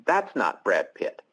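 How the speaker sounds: normal talking voice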